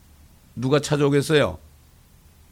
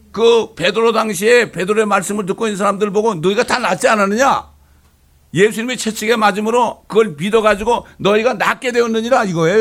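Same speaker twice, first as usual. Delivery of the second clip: raised, loud preaching cadence; full-force preaching; 7 semitones higher, 6 dB louder than usual. Acted. Pastor exhorting the congregation, loud and fervent. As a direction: preach it out to the whole church.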